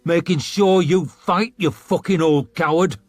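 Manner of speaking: contemptuous snarl. A narrator's reading. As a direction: spit the insult. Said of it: "snarled Magwir".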